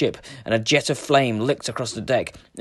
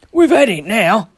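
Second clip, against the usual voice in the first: despairing cry; 9 semitones higher, 6 dB louder than usual. A narrator's reading. Men fear the dragon.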